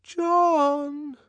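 high, drawn-out timid call; small ingratiating voice. A narrator's reading. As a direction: call coaxingly from behind a closed door. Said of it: "ventured a small, ingratiating voice from the bathroom".

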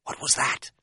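voiceless, hushed question; hushed and on edge; anxious whisper. A narrator's reading. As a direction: whisper it, alert to a noise. Frightened whisper after a noise.

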